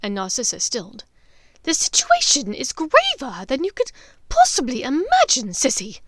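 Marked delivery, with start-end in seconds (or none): 1.6-6.0 high, loud urgent warning; grave witch. Acted. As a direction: press the danger on her sister.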